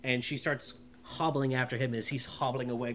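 ordinary delivery

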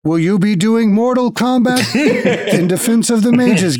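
high robot voice cracking with laughter; automaton breaking into laughter. Acted. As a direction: keep the machine voice while laughing.

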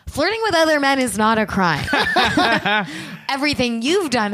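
chuckling through the cop's line; laughing. Retold or imitated. Quoting the cops to Sherry, laughing through it.

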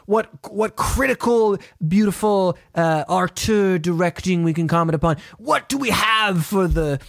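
hammed-up art critic addressing the masses; mock-pompous grandiosity. Host grandly asking co-host for next week's film, mock-pompous.

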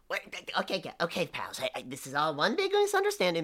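small, high pleading man; pleading little man voice. Acted. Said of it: "a little voice behind you".

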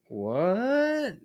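high, baffled what?; incredulous what. Real, not acted. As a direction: act totally baffled. Incredulous reaction.

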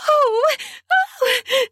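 high pleasured squeals; breathless high-pitched cries; squealing gasps. A narrator's reading. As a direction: gasp in, then squeal high. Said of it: "so hard I squealed".

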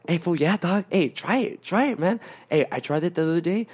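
upbeat mock peer pressure; happy, eager. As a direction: push it on them cheerfully. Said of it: "he's all happy".

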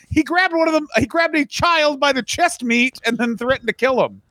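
very high, hammed-up outrage; mock distressed complaint. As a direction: whine it way up high, mocking them. Mimics the complaint to the cops.

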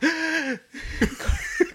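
high, long hammed-up gasp; cartoonish horrified intake of breath; mock gasp. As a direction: gasp in exaggerated shock. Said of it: "make Carmen gasp".

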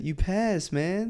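imitating the chill proctor; cool hippie vibe. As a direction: say it like a relaxed hippie. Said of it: "he's a real cool vibe to him".

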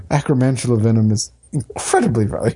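normal talking voice

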